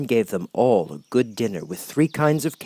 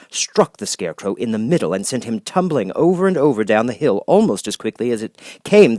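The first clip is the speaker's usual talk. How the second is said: plain reading voice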